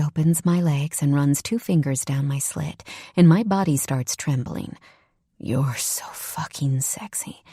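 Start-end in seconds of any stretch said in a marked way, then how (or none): 5.4-7.4 slow breathy whisper; husky lover's whisper, drawn out. Acted. Lover whispering to Sarah, a slow whisper.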